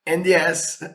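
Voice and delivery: usual speaking voice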